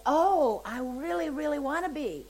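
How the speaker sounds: high, emphatic eager plea; eager yearning. Retold or imitated. Man answering the offer to be set free, eager yearning. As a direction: burst out loud on "Oh", then plead eagerly.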